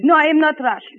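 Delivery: put-on denial in a thick Russian-sounding accent; firm no in a Russian-style accent. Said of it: "Your dialect sounds Russian".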